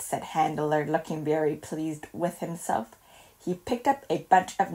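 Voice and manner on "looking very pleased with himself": ordinary delivery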